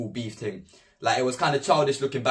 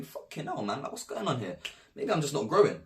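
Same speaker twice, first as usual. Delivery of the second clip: inner thought; 6 dB quieter than usual. Retold or imitated. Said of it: "I'm thinking".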